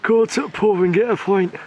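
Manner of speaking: mother asks for it again, he overplays it; awful northern accent. Pretend. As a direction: ham up a bad northern lad. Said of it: "That was awful".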